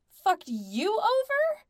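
loud, drawn-out shrill disbelief; high and shrill. A narrator's reading. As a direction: screech it slowly in outrage. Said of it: "voice sounded high and shrill".

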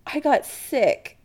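high, slow disbelief; incredulous. Acted. Chris correcting Adam about his past, incredulous. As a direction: draw it out incredulously. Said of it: "Chris says incredulously".

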